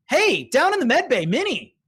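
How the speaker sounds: loud, high call across the room. Acted. Calling down to Minnie in the med bay, loud. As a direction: shout to get Minnie's attention.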